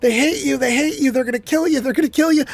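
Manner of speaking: mock-outraged chant of what the critics say; mocking the accusers. Preacher mimicking critics of those in power, mock-alarmed. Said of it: "They're standing there saying".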